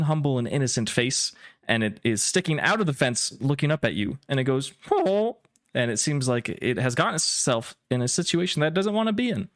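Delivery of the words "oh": a high, distressed little creature "Oh"; creature whimper. Acted. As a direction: whine like a trapped pet pokemon. Creature stuck in fence.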